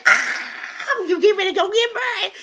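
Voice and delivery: very high, fast excited squeal; mock freak-out. Bride-to-be after grandfather's blessing, mock freak-out squeal. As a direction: squeal it in an acted panic.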